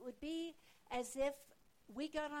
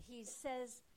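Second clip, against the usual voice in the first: ordinary talk, nothing marked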